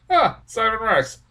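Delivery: mock surprised realization, voice lifted; like spotting a familiar face; ah, there he is; surprised recognition. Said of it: "I was like".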